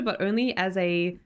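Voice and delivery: ordinary talk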